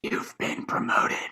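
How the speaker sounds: slow, whispered gravel of a caped hero; Dark Knight Batman voice. Pretend. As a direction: breathe it out low and quiet like Batman. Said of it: "very Dark Knight Batman voice".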